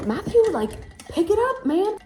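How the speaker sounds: high, loud nagging call; mother-in-law urging. Retold or imitated. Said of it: "His mom was like".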